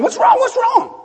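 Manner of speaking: very high, urgent questioning; high-pitched mock alarm; acted frantic concern. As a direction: plead to know the trouble. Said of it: "trying to calm him down and ask him".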